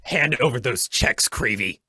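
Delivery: loud, commanding order; stern demand. Acted. Hornet demanding the checks from Creevy, stern and loud.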